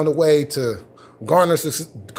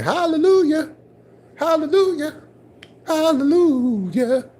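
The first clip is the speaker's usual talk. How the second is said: sung-out high hallelujahs, mocking a preacher; a hammed-up hint of gospel praise; mock churchy hallelujah; 9 semitones higher than usual. Mocking his church conversion.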